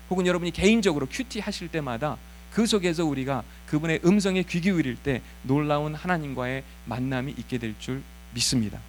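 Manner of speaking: ordinary talk, nothing marked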